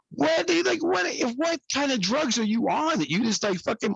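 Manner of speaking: hammed-up stunned outrage; incredulous disbelief. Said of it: "I was like".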